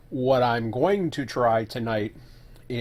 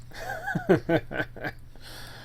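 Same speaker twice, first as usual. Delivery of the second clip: long high-pitched laugh; 3 dB quieter than usual. Real, not acted. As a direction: let out a squeaky giggle. Laughs at own failure.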